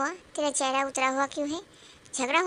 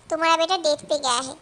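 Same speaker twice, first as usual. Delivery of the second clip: ordinary talk, nothing marked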